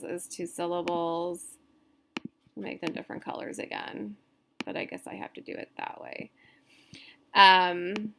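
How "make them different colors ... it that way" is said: under-the-breath mutter to self; quick unvoiced grumble to herself, fast; muttered aside. Real, not acted. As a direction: mumble it to yourself while fiddling with the screen. Muttering to herself while editing.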